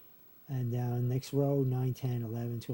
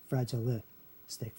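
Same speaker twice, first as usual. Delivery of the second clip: slow "Fragilistic", broken before the final stic; sounded out slowly; slower than usual. Shown as a demonstration. Teacher showing where li falls, slow and split. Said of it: "be pronounced li there".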